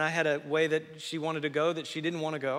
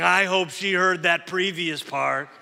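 husband's retort; 2 semitones higher, 9 dB louder, slower than usual. Pretend. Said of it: "every husband is saying".

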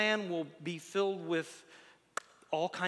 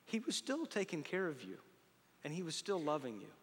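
ordinary talk, nothing marked

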